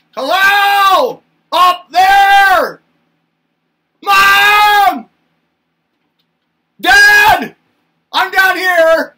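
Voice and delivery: put-on high, loud little-kid shout upward; a toddler calling up to mom and dad, high and loud. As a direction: yell up to the giants from the floor. Toddler calling up to tall parents.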